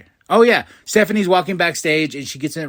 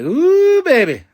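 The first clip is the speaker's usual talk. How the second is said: high, loud swooping ooh; thrilled whoop; 13 semitones higher, 8 dB louder, slower than usual. Retold or imitated. Fan reacting to champ versus champ, thrilled whoop.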